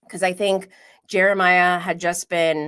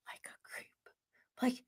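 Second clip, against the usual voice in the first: whispered aside; 6 semitones higher, 18 dB quieter than usual. Real, not acted. Aside about Huda eavesdropping.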